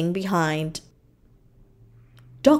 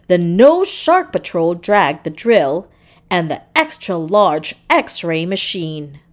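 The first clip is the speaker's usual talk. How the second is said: plain reading voice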